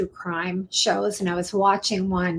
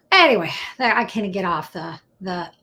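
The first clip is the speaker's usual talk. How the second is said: usual speaking voice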